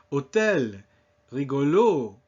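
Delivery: hôtel, rigolo with the final syllable stressed; French hôtel, rigolo, weight at the end; stress on last syllable. Shown as a demonstration. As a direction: punch the -tel of hôtel and -lo of rigolo. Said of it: "Always on the last syllable".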